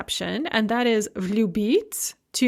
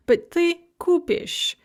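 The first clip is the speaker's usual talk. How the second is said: kupish stressed on ku, higher and slow; slow, lean on the u of kupish; KUpish, first syllable stressed; 7 semitones higher than usual. Shown as a demonstration. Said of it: "the stress on the u".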